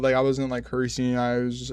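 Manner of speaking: ordinary talk, nothing marked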